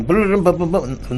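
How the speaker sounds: loud, high burst of booms; imitated booming noise. Imitating the theater's sudden roar as the film starts, booming. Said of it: "noise, and".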